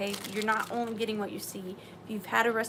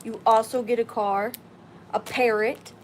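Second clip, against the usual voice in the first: ordinary delivery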